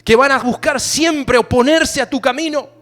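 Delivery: rally the church against the wind; preaching at full force. Acted.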